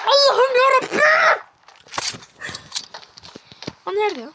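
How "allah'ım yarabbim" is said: desperate plea. Real, not acted. Panicked plea mid-fight.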